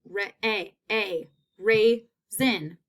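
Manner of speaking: "ra-, a, a" drawn out slowly before "raisin"; sounding out. Shown as a demonstration. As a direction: stretch the A, then say the word.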